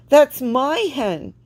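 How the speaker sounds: high, loud and slow protest; an upset, slow loud claim; crying out. A narrator's reading. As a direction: plead loudly with the fisherman, stretching each word. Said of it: "she cried to the fisherman".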